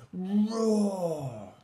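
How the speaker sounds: high, loud, long falling roar; mouse roaring. A narrator's reading. Mouse coaxing Little Lion, long high roar. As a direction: stretch it into a roar.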